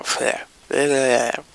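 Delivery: mock the teacher's droning with nonsense; mocking bleh babble.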